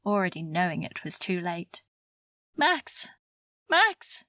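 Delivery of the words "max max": high-pitched urgent cry, rising louder; woman calling out. A narrator's reading. Woman waking a man from his nightmare, high urgent call. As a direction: call the name high and sharp to rouse him. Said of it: "A woman's voice this time".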